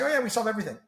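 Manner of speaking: imitating a competitor's breezy overclaim, pitched high; mock boastful competitor. Tech founder mimicking competitors who claim too much, mock boastful. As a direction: toss it off high, mimicking empty bragging. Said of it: "just say".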